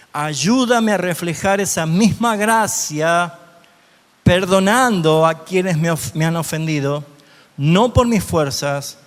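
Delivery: heartfelt model prayer; prayerful reading. Shown as a demonstration. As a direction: pray it aloud as a model for the congregation. Model prayer read aloud.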